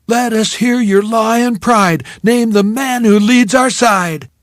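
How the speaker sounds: high, loud, slow chant; cheerleader yell. Retold or imitated. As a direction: call it to the stands like a cheerleader. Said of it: "The cheerleaders would yell something like".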